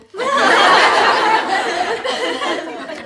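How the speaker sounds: high laugh; real laughter; laughing at the penguin punchline. Real, not acted. Laughs at own joke.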